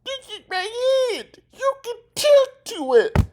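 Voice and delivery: very high mocking villain voice; Rodney voice. Quoting Rodney taunting Doofenshmirtz, very high voice. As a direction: sneer it high like a smug rival. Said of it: "he says this".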